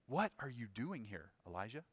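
"What" stressed in a hushed voice; soft, searching question weighted on "What". Shown as a demonstration. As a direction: quietly lean on "What", let the name fall away. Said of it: "putting the emphasis on the what".

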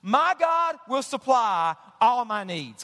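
slow, stressed My on My God; personal emphasis. Shown as a demonstration. Pastor stressing Paul's personal promise, slow and weighty. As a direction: lean on My, slowly. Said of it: "he personalizes it".

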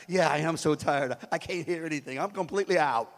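low, badly faked sleepy voice; fake sleeping. Dad dodging the mowing, low fake-sleeper voice. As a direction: play exhausted and dead to the world. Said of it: "You start fake sleeping then".

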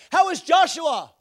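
high, loud mimicked holler; Brother Vic. Missionary asking after another son, imitated as shouting. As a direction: shout the question down the phone. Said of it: "Why are you shouting?".

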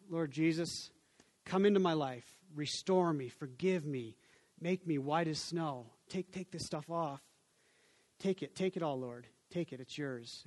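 soft, low prayer of surrender; humble prayer. Acted. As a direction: pray it quietly, giving everything up. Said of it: "We bend, bend a knee down".

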